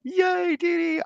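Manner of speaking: high, delighted cheer for Dee Dee; excited squeal. Real, not acted. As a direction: squeal the name with joy.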